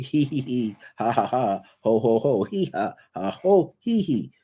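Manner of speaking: forced hee, ha, ho laughing syllables; demonstrated laughter. Shown as a demonstration. Teacher showing listeners how to just laugh, forced hee-ha-ho laughter. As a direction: laugh on purpose to show the practice. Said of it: "Here's the laughter, see?".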